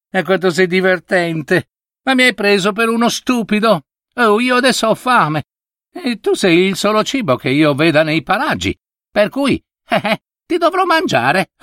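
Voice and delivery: scornful leopard. A narrator's reading. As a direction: taunt him, chuckling at your prey. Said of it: "con una risata di scherno".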